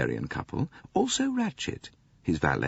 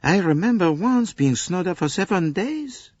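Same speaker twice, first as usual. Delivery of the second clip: high, exasperated French conductor; a harried Frenchman pouring out his troubles; 13 semitones higher, 3 dB louder than usual. Acted. Conductor complains to Poirot about the American lady, exasperated.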